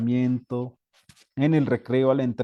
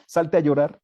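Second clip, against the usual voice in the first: louder harsh teacher; 3 semitones higher, 7 dB louder than usual. Acted. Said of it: "una parte negativa del docente".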